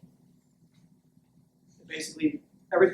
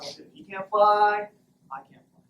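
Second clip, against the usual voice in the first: mock discouraged; 4 semitones higher than usual. Penguins seeing their friend fail, mock discouraged. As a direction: give up, trailing down to a murmur. Penguins give up after failure.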